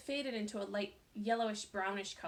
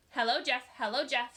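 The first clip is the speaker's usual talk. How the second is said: taunting; 3 semitones higher, 8 dB louder than usual. A narrator's reading. Lori taunting.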